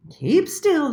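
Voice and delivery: high, loud order; buzzing bossily; fly voice. A narrator's reading. The flies telling Little Mole to hold still, high and loud.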